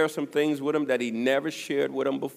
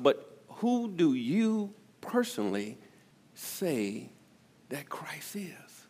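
hushed pointed question; 4 dB quieter, slower than usual. Real, not acted. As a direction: drop to a slow whisper on the last words. Preacher presses personal question.

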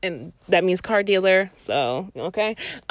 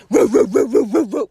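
mock dog barking; 4 dB louder than usual. Imitating roommate's dog barking.

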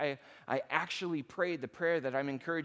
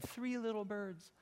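higher and softer, cooing the phrase like his wife; echoing wife; 5 semitones higher, 8 dB quieter than usual. Retold or imitated. Pastor echoes wife's delight at the nest, higher and softer. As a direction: repeat her words with her gentle coo. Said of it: "is what she said".

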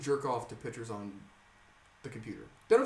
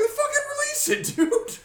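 much higher, louder, laughing mock outrage; incredulous shout; 9 dB louder, slower than usual. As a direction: yell it like the threat is absurd. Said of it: "I'm like".

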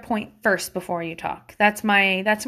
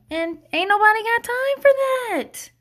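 meme quote; 11 semitones higher, 3 dB louder than usual. Shown as a demonstration. Quoting meme phrase.

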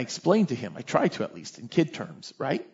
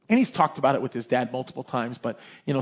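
ordinary talk, nothing marked

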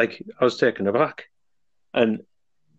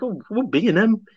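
flabbergasted; 8 semitones higher than usual. Retold or imitated. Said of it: "I was so flabbergasted by it".

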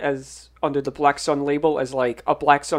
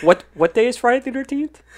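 mildly puzzled, higher-pitched question; blank confusion; 7 semitones higher than usual. Retold or imitated. Retelling friend's clueless question at the party, mock blank confusion. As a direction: ask it like you honestly have no clue. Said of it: "Ian blankly looks at you and me".

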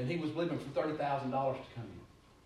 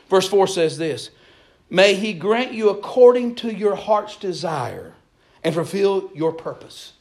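full-force preaching; 4 semitones higher, 13 dB louder than usual. Acted. Preacher reading Psalms to his congregation, loud and fervent. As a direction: drive every line home to the congregation.

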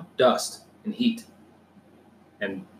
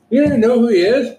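shocked disbelief; 13 semitones higher, 7 dB louder than usual. Real, not acted. Shocked friend doesn't know player.

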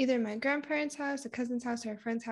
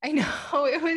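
a real tearful laugh, louder and higher; 4 semitones higher, 7 dB louder, faster than usual. Real, not acted. Admitting to a friend that it's sad, with a teary laugh. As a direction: laugh through it, half choked up. Laughing about living alone.

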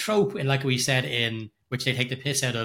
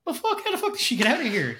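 exasperated viewer; 13 semitones higher than usual. Retold or imitated. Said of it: "And you're like".

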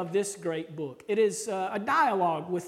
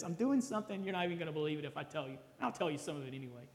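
casual aside; 3 semitones lower, 9 dB quieter, much faster than usual. Acted. Paraphrasing God casually.

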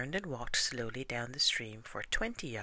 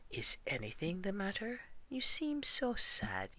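worried concern; 8 semitones higher, 6 dB louder than usual. A narrator's reading. Pooh asking sad Eeyore, worried and high. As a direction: fret over a sad friend.

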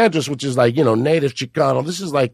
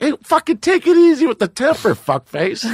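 imagined comeback; 8 semitones higher than usual. Retold or imitated. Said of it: "but then you're like".

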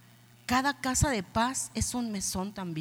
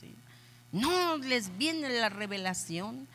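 usual speaking voice

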